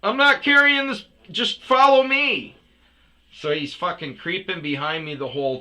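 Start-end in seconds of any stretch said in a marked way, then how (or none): 0.0-2.6 loud, high, exasperated command; angry order. Retold or imitated. Customer to creeping driver, loud angry order. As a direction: order them along at a yell.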